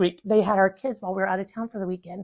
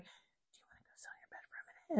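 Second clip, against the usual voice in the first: soft unvoiced whisper to a child; whispered offer; 6 semitones higher, 19 dB quieter, faster than usual. Mom whispering to upset daughter, hushed. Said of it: "I'm like".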